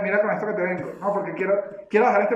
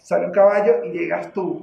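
ordinary delivery

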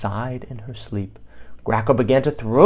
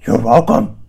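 beast voice; 8 dB louder than usual. A narrator's reading. Beast answering bird.

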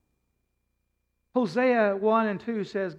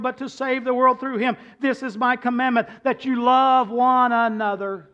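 loud, ringing scripture read from the pulpit; 7 semitones higher, 10 dB louder than usual. Acted. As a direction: proclaim the verses to the whole church. Reads scripture aloud forcefully.

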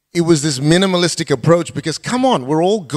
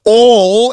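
ALL said loud and drawn out; "all" ringing out to the congregation; stressed all; 4 semitones higher, 8 dB louder than usual. Real, not acted. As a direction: land hard on "all". Pastor reading the Great Commission.

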